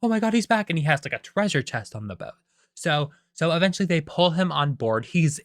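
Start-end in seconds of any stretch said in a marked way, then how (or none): 0.0-0.7 high-pitched mock crew in a frenzy; freaking out. Pirates spot their man returning, high mock freak-out. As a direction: act out a crowd losing it, high and urgent. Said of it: "like, freaking out".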